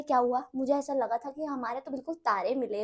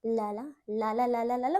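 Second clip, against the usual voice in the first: soft dreamy voice; 2 semitones lower, 3 dB quieter, slower than usual. Retold or imitated. Sweet boy voiced dreamily.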